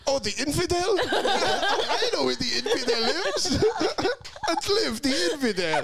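high, slow, laughing mock voice; mock locals. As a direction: ham up the locals spotting an outsider.

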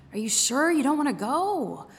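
lightly voiced annoyed owner coaxing; glaring owner. A narrator's reading. Owner glaring at her lazy dog, mildly exasperated. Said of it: "she would say while glaring at me".

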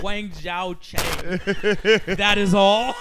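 loud, drawn-out mock school announcement; school announcer. Student reading prank announcements to the whole school, loud announcer delivery. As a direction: boom out the words like a prank PA announcement. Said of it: "I used to do the announcements".

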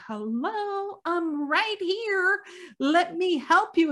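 high, drawn-out "hello, I'm right here"; exasperated. Retold or imitated. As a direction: sing it out slowly to someone not listening. Exasperated daughter to fallen father.

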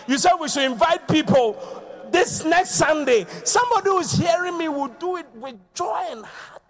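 loud, high preacher's cry; preaching at full force. Real, not acted. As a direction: preach it out with joy.

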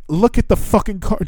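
high-pitched retold exasperation; fed-up cussing. Traveler demanding the line attendant look at his card, exasperated. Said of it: "So now I'm cussing at her".

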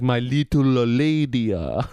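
slow, drawn-out mock character voice; put-on slow anime guy voice; lady-loving anime guy. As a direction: stretch it out, then drop low. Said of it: "this guy that's like".

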